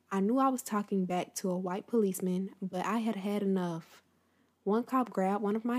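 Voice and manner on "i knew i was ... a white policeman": ordinary delivery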